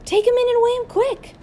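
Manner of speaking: urgent order. A narrator's reading. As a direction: call the order down to the balcony below. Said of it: "Mister Hoppy ordered".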